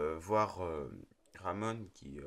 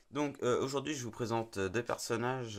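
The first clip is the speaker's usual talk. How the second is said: ordinary delivery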